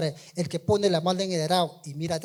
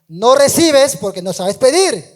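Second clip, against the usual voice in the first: loud, slow, raised pitch, hammering each word; preaching shout; 6 semitones higher, 13 dB louder, slower than usual. Acted. Preacher booming Santiago's words at the church, loud and slow. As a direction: thunder the scripture at the congregation. Preacher thunders scripture quote.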